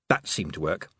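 usual speaking voice

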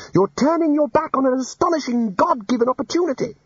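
high, pressing outburst; exploding. Acted. Ponder scolding the departing wizards, high exasperated outburst. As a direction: keep the exasperated outburst going. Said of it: "Ponder exploded".

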